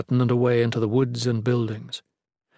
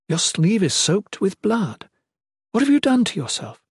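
worried queen voice; 8 semitones higher than usual. Acted. Queen alarmed at bloody sleeve.

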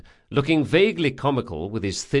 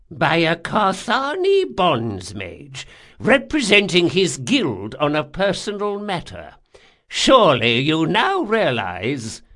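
put-on high, loud old man's voice; insistent old man; 6 semitones higher, 5 dB louder than usual. Old man tells intruder who warned him, insistent.

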